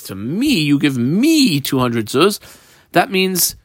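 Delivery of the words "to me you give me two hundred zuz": "me" stretched out each time it comes; stress on me. Shown as a demonstration. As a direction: lean slowly on each "me". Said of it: "I put that word in quotation marks".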